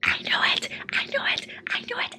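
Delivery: breathy whisper to herself. Real, not acted. Reader whispering her delight over a book twist. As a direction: whisper it like a secret thrill. Hushed reaction while reading.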